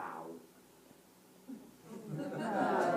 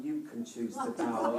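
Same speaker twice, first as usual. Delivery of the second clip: ordinary talk, nothing marked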